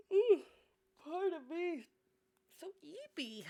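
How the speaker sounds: high, drowsy yawning voice; mock-sleepy mumble; sleepy character. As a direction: yawn it out half-asleep.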